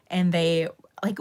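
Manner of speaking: normal talking voice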